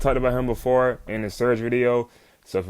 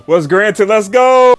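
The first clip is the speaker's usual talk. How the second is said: shouted, much higher and louder; hyped cheer; 8 semitones higher, 7 dB louder, slower than usual. Real, not acted. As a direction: cheer it out like your team just scored. Hype over a wish granted.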